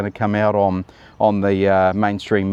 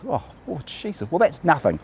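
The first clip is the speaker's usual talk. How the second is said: ordinary talk, nothing marked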